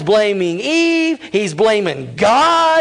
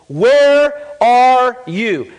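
slow, drawn-out divine call; God calling out; 3 semitones higher, 4 dB louder, much slower than usual. Acted. God calling slowly to hiding Adam. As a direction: call slowly into the garden, searching.

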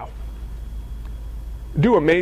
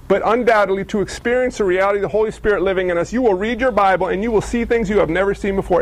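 usual speaking voice

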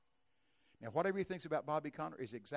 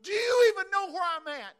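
desperate, much higher and louder; agitated scream; 17 dB louder than usual. Retold or imitated. Old woman crying to God while plucking duck feathers, screaming. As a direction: cry out to God in frustration. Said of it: "I screamed out in a moment of agitation".

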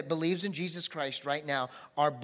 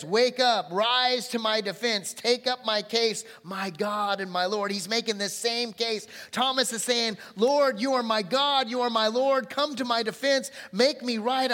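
preaching at full force; 6 semitones higher, 8 dB louder than usual. Acted. Preacher proclaiming the psalm plea, loud and high. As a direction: cry out the psalm to God.

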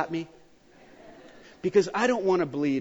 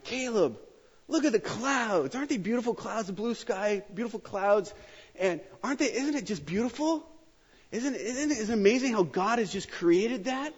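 gushing to son; 8 semitones higher than usual. Retold or imitated. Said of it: "and I'm like".

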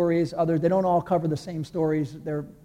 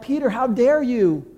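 preacher rebuking Peter, pitched up; indignant rebuke; 6 semitones higher than usual. Retold or imitated. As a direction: scold Peter, higher, in disbelief. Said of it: "I look at this picture and I'm like".

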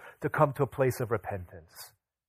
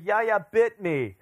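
put-on slow, higher little-girl voice, lightly done; a hint of a tearful toddler complaining; imitating little daughter; 8 semitones higher, 4 dB louder, much slower than usual. Daughter quoted crying to parents.

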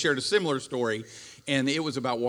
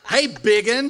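higher, louder "Hey, biggin" with a rising snap; mock snapping wife; 7 semitones higher, 11 dB louder, slower than usual. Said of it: "if you say".

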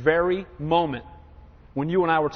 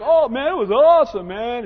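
higher, louder, laughing brag; gleeful gym guy; 3 semitones higher, 5 dB louder than usual. Retold or imitated. Preacher mimicking the laughing gym guy, mock gleeful brag. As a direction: relish it like a proud drinker. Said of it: "He's laughing".

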